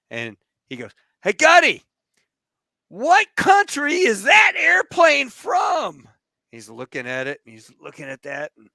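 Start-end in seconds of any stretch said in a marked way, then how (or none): 1.2-6.1 very high, drawn-out shout; acted yell. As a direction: holler each word slowly over engine noise. Officer yells across the plane, retold.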